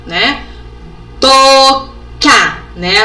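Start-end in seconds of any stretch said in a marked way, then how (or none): 1.2-2.6 "Tocar" split into two loud, drawn-out beats, "to, car"; syllable by syllable. Shown as a demonstration. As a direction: pause between the syllables of "Tocar". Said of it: "As quantidades de sílabas".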